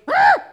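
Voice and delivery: sharp startled yelp, high and loud; a comic cry of dismay as the shot goes in; shrill cartoon Satan shriek; Satan's yelp. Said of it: "and Satan goes".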